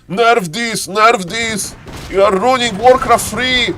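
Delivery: high mock whine of angry fans; booing crowd. LAN audience protesting after every Polar Rifle win, mock outrage. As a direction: complain in a high, protesting chant. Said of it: "the people, like, be like".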